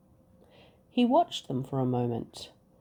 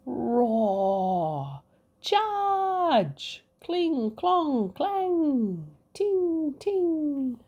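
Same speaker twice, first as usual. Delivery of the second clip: battle noises; 11 semitones higher, much slower than usual. Acted. Narrator calling out the fight between king and dragon. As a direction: shout the battle like a playful clash.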